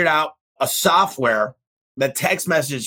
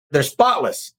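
normal talking voice

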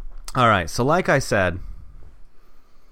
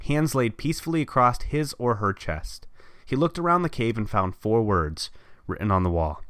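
reading a story aloud; steady storyteller read; story narration; 4 semitones lower, 5 dB quieter than usual. Acted. Reads own story aloud.

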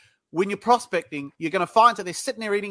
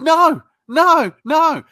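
higher and louder, cutting him off; firm loud no; 9 semitones higher, 8 dB louder, much slower than usual. Retold or imitated. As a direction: shut the salesman down hard. Cutting off the salesman.

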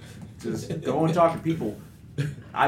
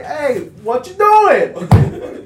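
high, loud, very slow drawn-out hello; mock Italian greeting; 9 semitones higher, 8 dB louder, much slower than usual. Imitating people who greet him as Italian, hammed-up wiseguy voice. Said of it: "Hey, like".

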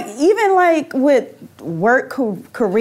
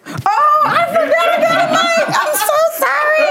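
hands knocking the mic; talking while bumping the mic; tapping the mic. Real, not acted. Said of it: "Stop hitting your mic".

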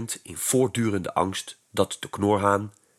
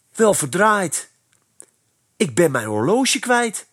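high, louder alarmed exclamation; startled cry; 8 semitones higher, 4 dB louder than usual. Acted. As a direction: suddenly realise the watch is gone. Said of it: "riep Kees verschrikt".

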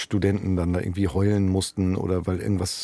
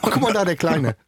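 high cooing voice, higher pitch; cooing onlooker; 7 semitones higher than usual. Pretend. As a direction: coo at a little boy. Mocking onlooker, shorts joke.

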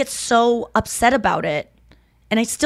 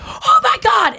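high loud cry; screamed last words; 5 dB louder, slower than usual. Retold or imitated. Sister's last words before the crash, high shout. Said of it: "that was what he heard".

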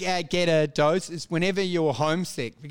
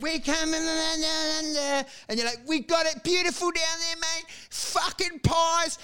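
homesick Aussie; 9 semitones higher than usual. Retold or imitated. Homesick Aussie in the UK, high mock-sentimental pride. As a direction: croon it like a teary expat.

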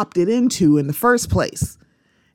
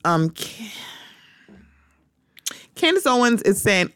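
ordinary talk, nothing marked

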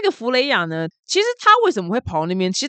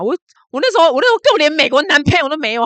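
higher, louder and fast, fired up; indignant outburst; 7 semitones higher, 6 dB louder, faster than usual. Real, not acted. Vlogger ranting at an old commenter, heated and loud. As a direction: blurt it out in a heated rush.

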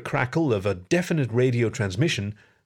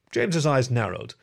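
usual speaking voice

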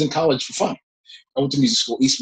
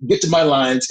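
ordinary delivery

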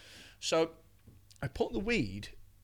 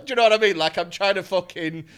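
normal talking voice

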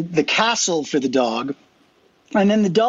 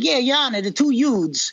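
put-on higher Brooklyn accent, "youths" stretched; My Cousin Vinny accent; 6 semitones higher, slower than usual. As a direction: play the Brooklyn lawyer from the film. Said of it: "like, my cousin Vinny".

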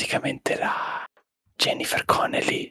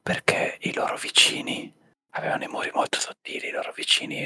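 whispering. Real, not acted. Whole ASMR podcast whispered.

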